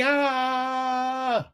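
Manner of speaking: very high, cartoonish "Ah!" of despair; hammed-up panicked cry; mock wail. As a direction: throw up your hands with a shriek. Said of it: "we freak out".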